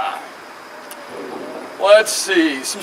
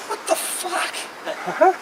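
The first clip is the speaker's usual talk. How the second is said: slow disbelief; 9 semitones higher, 8 dB quieter, much slower than usual. Real, not acted. Comic disbelief at clown offer.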